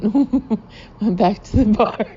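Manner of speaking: real giggles through the words; laughing. Real, not acted. Teller recounting groomsmen abandoning the horse, laughing. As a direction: struggle through the line while cracking up. Narrator cracks up at own story.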